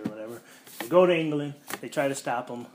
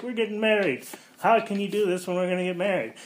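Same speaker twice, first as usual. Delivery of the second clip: higher, louder mock upset complaint; upset bride; 8 semitones higher, 6 dB louder, faster than usual. Sue scolds Reed over wedding, mock upset whine. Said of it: "Sue's kinda upset".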